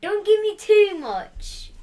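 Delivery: high, loud, slow plea. Real, not acted. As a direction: beg loudly, stretching it. Boy begging against more beans.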